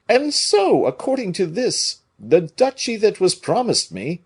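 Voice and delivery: fretting about his duchy; Porthos voice. A narrator's reading.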